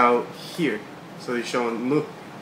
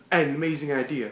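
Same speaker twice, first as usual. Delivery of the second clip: ordinary talk, nothing marked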